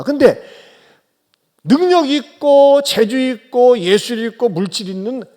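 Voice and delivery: fervent sermon voice rising at the climax; preaching. Acted. Pastor preaching to his congregation, fervent sermon.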